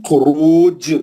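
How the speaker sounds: slow, drawn-out recitation with a bouncing qalqala at the end; tajweed recitation, strong qalqala on the final letter; Quran recitation. Shown as a demonstration. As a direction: recite it slowly and let the last letter echo. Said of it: "ये साकिन कर दे रहा हूं मैं रोकने के लिए".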